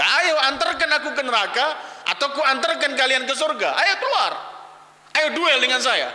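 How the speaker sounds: high-pitched imitated warrior's challenge; shouted taunt. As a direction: act out a cocky dare to a duel. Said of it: "Sambil teriak". (teriakan menantang yang congkak)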